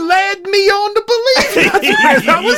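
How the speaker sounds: high, loud laughing protest. Real, not acted. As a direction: protest while laughing hard. Laughing at being tricked.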